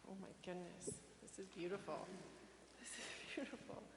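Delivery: soft, breathy, moved to tears; hushed and overwhelmed; shaky sigh. Real, not acted. Overcome opening gift.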